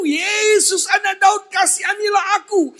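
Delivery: high, desperate cry for mercy; Bartimeus. Acted. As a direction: call out to Jesus with all your strength. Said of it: "Bartimeus berseru".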